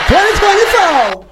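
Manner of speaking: high-pitched imitation of a rally cry; hammed-up high campaign yell; mock rally shout. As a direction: echo the politician's crowd-rousing holler. Echoing the clip's rally shout.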